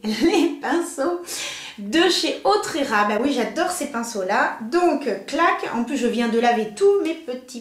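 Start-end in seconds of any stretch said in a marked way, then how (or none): 0.0-3.0 very slow, deliberate restart after a laugh; careful retry. Real, not acted. Vlogger retrying the brush brand name, slow and careful. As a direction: take it slowly this time. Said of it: "mais je vais pas y arriver".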